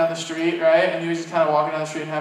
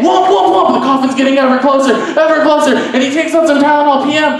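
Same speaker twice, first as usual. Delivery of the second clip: high, driven, breakneck-chase narration; frantic joke storytelling; 9 semitones higher, 7 dB louder than usual. Acted. Telling a crowd of a coffin chase, frantic storytelling. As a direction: build the chase with hammed-up panic and thumping sound effects.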